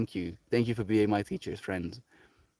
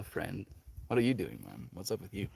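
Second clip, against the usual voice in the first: usual speaking voice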